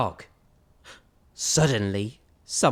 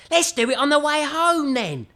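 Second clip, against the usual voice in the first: higher eager voice; little skeleton voice; 11 semitones higher than usual. Acted. Little skeleton plans to scare someone on the way home. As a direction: brightly suggest it.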